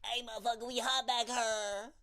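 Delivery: high mock complaint about the heat; woman yelling from the back. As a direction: holler it over and over at the back of a hot bus. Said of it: "repeatedly going".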